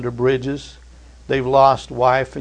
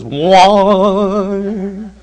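sudden high cartoon wail; mock wail; 6 semitones higher, 6 dB louder than usual.

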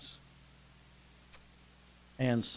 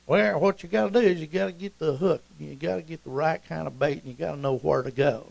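higher, twangy Oklahoma fisherman voice; brother's Oklahoma accent; 3 semitones higher than usual. Acted. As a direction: explain it like an Oklahoma know-it-all angler. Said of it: "I'll talk like my brother".

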